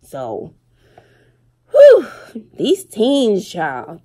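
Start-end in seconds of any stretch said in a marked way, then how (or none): 1.7-2.1 loud whew. Real, not acted. Exhausted sigh after the story.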